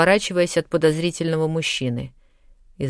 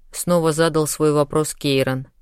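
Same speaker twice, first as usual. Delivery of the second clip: ordinary delivery, nothing marked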